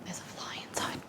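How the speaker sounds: hushed whisper about a fly. Real, not acted. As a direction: whisper it, barely any voice. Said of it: "I don't know why I'm whispering".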